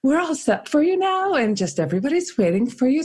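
high, sing-song phone-call politeness; imitated chirpy summons; wedding planner. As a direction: mimic a planner coaxing the bride down. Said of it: "The wedding planner calls and says".

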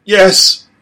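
put-on desperate young voice; boy's anguished yes. A narrator's reading. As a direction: wrench the word out. Boy admits loving dog.

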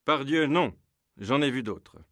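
loud, bold refusal; courageous defiance. Acted. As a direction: stand up to the bandits loudly. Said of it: "avec courage".

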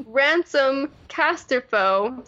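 Casterfo slow, second syllable stressed; careful pronunciation. Shown as a demonstration. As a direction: spell out the name by sound. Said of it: "silent L, emphasis on the second syllable".

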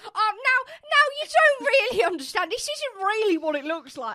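high, loud caught-out pleading; mock guilty friend. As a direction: whine it like you've been caught. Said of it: "And Katie was like".

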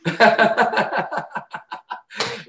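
high, breath-heavy wheezing laugh; helpless laughter. Real, not acted. Comic laughing at his own silly made-up name, high wheeze.

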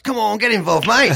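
hammed-up Cockney, pitched high; mock Cockney geezer calling out; awful Cockney accent. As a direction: do a bad high-pitched Cockney security guard. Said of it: "awful Cockney accent".

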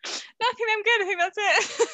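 high, laughing through words. Real, not acted. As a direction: giggle it out. Laughing fit winding down.

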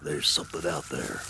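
low, hushed, tense warning. Acted. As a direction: whisper it, something's near. Noise heard in dark woods.

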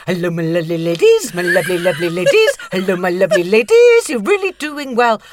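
loud, cooing greeting to her roses; sing-song to roses. Retold or imitated. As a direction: croon it out to the flowers like old friends. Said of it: "And I go".

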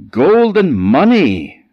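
father insisting on gold and money; insistent father. A narrator's reading. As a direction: hammer each word home. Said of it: "said his father".

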